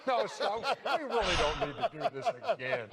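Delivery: laughing while begging to stop; half-laughing protest, quick. Real, not acted.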